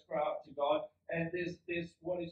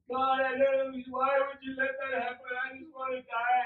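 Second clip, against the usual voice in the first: loud, high mock whine to God; hammed-up exasperated prayer; complaining believer; 5 semitones higher, 8 dB louder than usual. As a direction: protest to heaven in raised, self-pitying voice. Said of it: "how many of you have kind of like".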